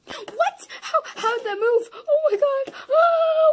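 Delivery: high, loud screaming cries; panicked scream. Real, not acted. Player startled by a moving animatronic, screaming. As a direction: scream in sudden fright.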